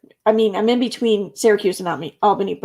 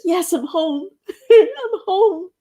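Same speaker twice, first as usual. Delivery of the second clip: sarcastic laughing joy; 10 semitones higher, much slower than usual. Retold or imitated. Rude toll booth, ironic joy.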